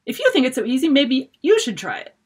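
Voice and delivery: defiant maid, mocking challenge; snorting retort. A narrator's reading. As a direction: toss it back with a sneer. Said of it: "I snorted".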